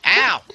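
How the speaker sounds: short, high, loud ow; yelp of pain. Real, not acted. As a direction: yelp as if just hit. Hit again in game.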